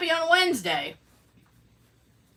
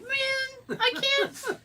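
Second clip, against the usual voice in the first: whiny mock; 13 semitones higher, 7 dB quieter, much slower than usual.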